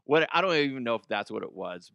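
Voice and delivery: ordinary talk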